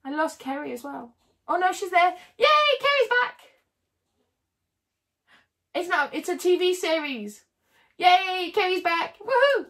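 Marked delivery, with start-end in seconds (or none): 1.5-3.4 much higher and louder happy yay; greeting a viewer back with delight; excited cheer. Real, not acted. Viewer rejoins the stream. 8.0-9.6 much higher and louder happy yay; greeting a viewer back with delight; excited cheer. Real, not acted. Viewer rejoins the stream.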